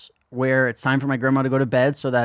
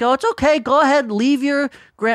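high, louder wounded grandma act; guilt-tripping grandma; 12 semitones higher, 4 dB louder than usual. Retold or imitated. Grandma to departing grandson, guilt-tripping. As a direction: imitate grandma laying on guilt, dragging the end.

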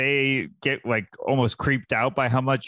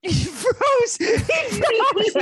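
much higher, squeaky laughing repeat; much slower than usual. Real, not acted. Friend cracking up at the shoe video, laughing. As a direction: crack up while saying it.

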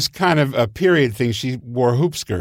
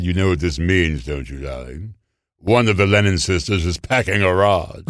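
a hint of Tallulah drawl; 5 semitones lower than usual. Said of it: "And she looked at this and said".

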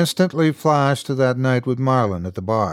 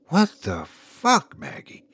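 slow, breathless disbelief; 6 semitones higher, slower than usual. A narrator's reading. Husband confronting wife over the suitcase, breathless. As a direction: gasp it out in shock. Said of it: "I asked breathlessly".

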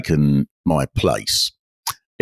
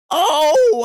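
high wailing "oh" of pain; a cry pitched far above his own voice; imitated howl of pain; 4 dB louder than usual. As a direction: let out a hurt umpire's howl. The umpire howls again.

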